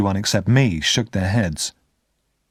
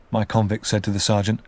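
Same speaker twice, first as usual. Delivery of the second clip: ordinary delivery, nothing marked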